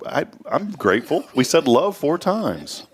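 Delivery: high-pitched sarcastic protest, drawn out at the end. Real, not acted. Host defending his thanks to co-hosts, sarcastic. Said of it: "A little less sarcastic".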